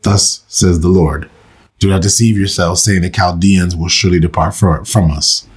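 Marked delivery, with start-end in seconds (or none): none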